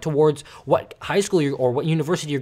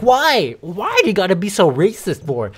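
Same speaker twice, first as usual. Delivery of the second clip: mock indignant protest; 3 semitones higher, 5 dB louder than usual. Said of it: "And I'm like".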